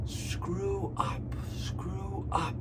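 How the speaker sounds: soft whispered "Screw up" twice; teacher whispering "screw up". Shown as a demonstration. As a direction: whisper it to the class like a secret. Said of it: "teacher whispers the phrasal verb".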